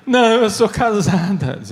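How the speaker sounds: a lightly put-on female voice; lightly voiced young wife; woman's voice. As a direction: answer as the married woman, voice changed a little. Woman answers the priest.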